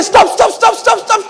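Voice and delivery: high, rapid-repeated plea; urgent hushing. Retold or imitated. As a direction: beg a wailing man to quit. Bystander hushing a crying man.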